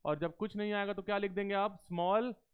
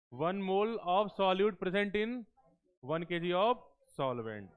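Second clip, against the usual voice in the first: slow dictation; 3 dB louder, much slower than usual. Shown as a demonstration. Teacher dictating definition.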